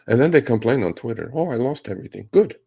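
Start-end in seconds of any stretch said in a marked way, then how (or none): 1.3-2.3 higher-pitched imitation of online complainers; mock their self-pitying complaint; mock complaining. Mimicking complainers on Twitter. 2.3-2.6 loud, high-pitched callous retort. Acted. Callous retort to the complainers' losses.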